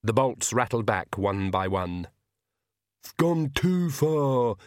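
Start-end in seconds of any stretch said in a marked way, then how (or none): 3.0-4.5 slow, drawn-out servant's grumble; muttering Igor. A narrator's reading. Igor complains to himself about his master, muttering. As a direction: grumble it in a put-on henchman voice. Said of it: "Igor muttered".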